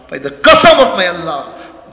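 loud, forceful swearing by Allah; emphatic oath. Real, not acted. Preacher repeating his oath to the congregation, loud and emphatic. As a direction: hit the oath hard.

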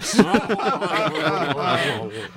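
high laugh. Real, not acted. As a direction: crack up laughing. Laughing at the joke.

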